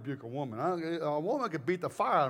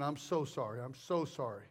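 lower, softer apology; 5 semitones lower, 7 dB quieter than usual. Retold or imitated. Preacher apologising to an offended heckler, soft and low. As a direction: drop down gently.

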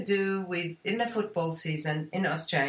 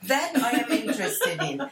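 laughing; 7 semitones higher, 4 dB louder than usual. Real, not acted. Jokes about cooking show, laughs.

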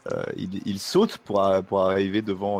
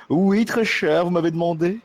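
grovel eagerly to your mistress; fawning servant; 7 semitones higher than usual. Acted. Servant bows to Adela.

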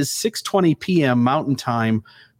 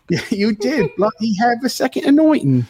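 put-on English accent; 8 semitones higher than usual. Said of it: "spoke with English accents tonight".

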